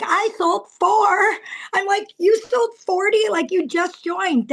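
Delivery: incredulous disbelief. Retold or imitated. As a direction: squeal like you can't believe it. Retold shocked reaction to friend.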